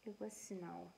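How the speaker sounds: ordinary delivery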